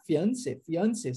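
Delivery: "Fiancé, fiancé" said twice in the same way; identical pronunciation. Shown as a demonstration. Teacher showing noivo and noiva sound alike, identical pronunciation of "fiancé". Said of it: "a pronúncia é idêntica".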